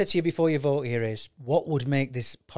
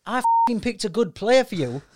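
higher and louder, playfully indignant; mock telling-off; 9 semitones higher, 6 dB louder than usual. Podcast host mock-scolds a co-host over his pick, playful loud telling-off. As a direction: bark it like a jokey threat to a mate.

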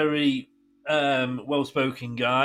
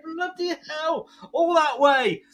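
amazed; 11 semitones higher, slower than usual. Retold or imitated. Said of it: "And I'm like".

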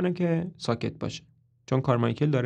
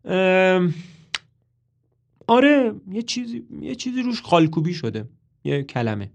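hesitant, halting higher voice; stammering; 6 semitones higher, 3 dB louder than usual. A narrator's reading. Toby stalling while lying to Carmichael, hesitant and halting. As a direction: fumble for the words, stalling. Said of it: "منمن کنان میگه".

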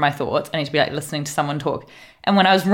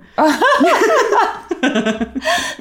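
high burst of laughter. Real, not acted. Big laugh at the story.

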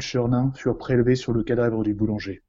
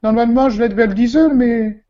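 high, loud falsetto widow; high woman's voice; 11 semitones higher, 7 dB louder than usual. Acted. Widow answering the inspector in a high, loud voice.

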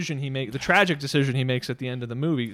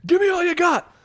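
very high, raised-voice outburst; sudden high demand, short of full volume; mimicked movie scream; 5 dB louder than usual. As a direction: imitate an interrogator bursting out. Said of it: "out of nowhere screams at him".

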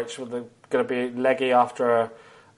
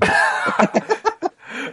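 high laugh; 13 semitones higher than usual. Real, not acted.

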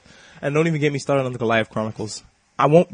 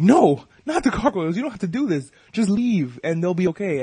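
higher, urgent retold plea, loud opening NO; acted pleading; 5 semitones higher, 5 dB louder than usual. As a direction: plead for their lives, as a storyteller. Captain pleads for the gargoyles.

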